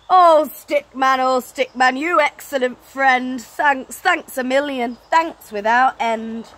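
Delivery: loud, hearty thanks; grateful Santa. Acted. Santa thanking Stickman, loud and hearty. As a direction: boom out your gratitude.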